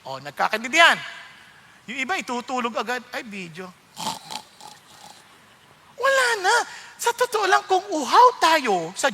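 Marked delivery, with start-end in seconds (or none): 3.1-3.7 1 bored sigh. Retold or imitated. Sleepy listener dismissing video.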